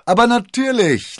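high, loud, merry exclamation; amused. A narrator's reading. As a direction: exclaim it with a touch of laughter. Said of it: "lachte D'Artagnan".

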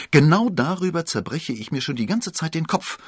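high, loud and animated; lively exclamation. A narrator's reading. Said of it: "rief D'Artagnan lebhaft". (lebhaft ausgerufen)

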